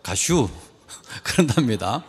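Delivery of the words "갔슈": put-on brief, understated Chungcheong accent, softer; Chungcheong dialect. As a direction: toss off one short word in Chungcheong dialect. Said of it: "충청도 사람들은요".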